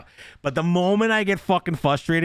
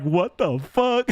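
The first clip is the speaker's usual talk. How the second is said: slow, drawn-out baffled voice; mock bewildered player; confused player; 11 semitones higher, much slower than usual. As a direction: sound like a stunned stranger. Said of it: "people are like".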